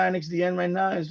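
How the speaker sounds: ordinary delivery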